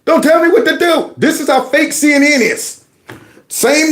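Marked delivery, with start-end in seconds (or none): 0.0-1.1 defiant bro. Retold or imitated. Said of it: "playing the clip where he's going".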